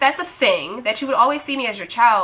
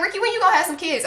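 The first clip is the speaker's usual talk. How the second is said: nosy relatives; 7 semitones higher, faster than usual. Retold or imitated. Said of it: "they be like".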